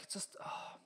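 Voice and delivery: exasperated whisper. Real, not acted. Ranting about Ryanair fees.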